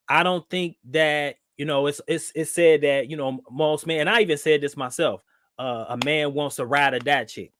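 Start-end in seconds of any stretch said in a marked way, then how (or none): none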